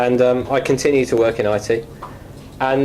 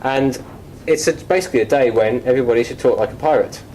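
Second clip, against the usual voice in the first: ordinary talk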